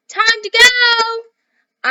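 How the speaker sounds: high, loud call from downstairs; mother calling upstairs. Acted. Mother calling her son from downstairs, loud high call. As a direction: shout it up the stairs like a mum. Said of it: "came his mother's call from down".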